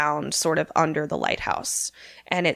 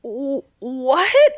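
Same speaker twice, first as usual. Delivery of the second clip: stunned stammer; 6 semitones higher, much slower than usual. Retold or imitated. Nat sees dead husband.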